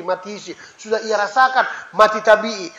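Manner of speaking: normal talking voice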